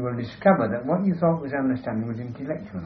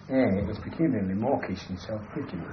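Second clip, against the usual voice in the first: ordinary delivery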